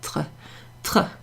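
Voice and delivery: "tr" with the R scraped in the throat; throaty tr. Shown as a demonstration. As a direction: show a throaty French R straight after the T in "tr".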